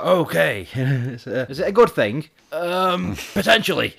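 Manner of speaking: high put-on voice, breaking into giggles; imitated farmer, loud and hesitant; mad farmer. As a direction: play the farmer through your own laughter. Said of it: "And he's like".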